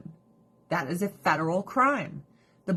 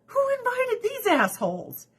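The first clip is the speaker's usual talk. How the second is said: much higher, indignant squeal; mock outraged host. Host mocking uninvited guests, high indignant squeal. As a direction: play an offended host at a party.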